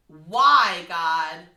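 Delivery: loud, imitated anger at God; angry cry. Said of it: "in anger".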